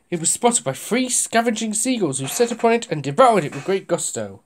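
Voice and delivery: ordinary delivery, nothing marked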